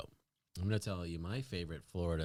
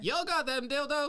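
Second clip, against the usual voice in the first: put-on Southern accent; 6 dB louder than usual. Joke customer asking in any store, high Southern accent. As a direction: holler it as a mock Southern customer.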